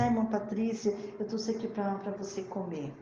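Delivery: soft, modest offer, fading at the end; quiet, humble. Retold or imitated. Church sister shyly offering food to the preacher, quiet. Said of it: "bem quietinha, bem humildezinha".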